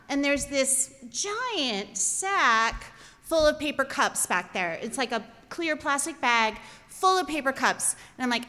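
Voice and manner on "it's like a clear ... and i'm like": usual speaking voice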